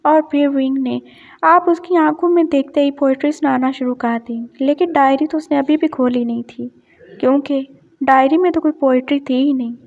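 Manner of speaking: ordinary delivery, nothing marked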